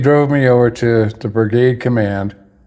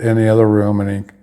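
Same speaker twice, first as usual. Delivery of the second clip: ordinary delivery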